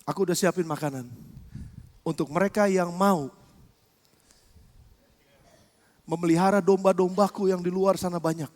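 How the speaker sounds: quiet voice of Jesus. Acted. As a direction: speak it gently as the Lord.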